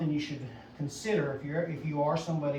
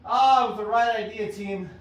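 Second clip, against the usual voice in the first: upbeat encouragement; 4 semitones higher, 7 dB louder than usual. Real, not acted. Cheering the shot to viewers.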